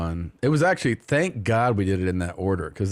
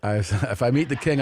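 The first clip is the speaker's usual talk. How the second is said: usual speaking voice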